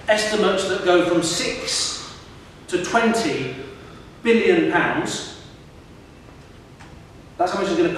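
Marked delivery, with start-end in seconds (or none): none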